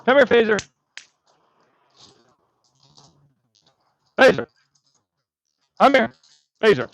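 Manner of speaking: shouting the dog's name; loud repeated calls; calling out. Real, not acted. Calling the dog.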